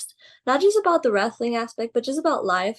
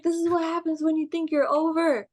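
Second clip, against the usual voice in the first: imitated scolding, pitched higher; Booker; 7 semitones higher than usual. Boss walking out on his trainee, mildly mock-angry scolding. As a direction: retell the boss's annoyed telling-off. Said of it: "he was just pissed".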